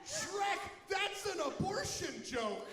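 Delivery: high, loud, slow outraged squawk; shocked donkey. Acted. Donkey scolding Shrek, high shocked squawk. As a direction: gasp it out as the scandalised sidekick.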